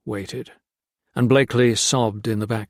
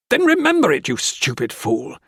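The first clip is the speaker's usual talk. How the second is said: furious outburst; 4 semitones higher than usual. Acted. Jones berates Blakely.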